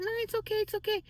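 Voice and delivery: smooth it over gently; a hushed high it's okay; high soft reassurance. Retold or imitated. Calming coworker at waiter.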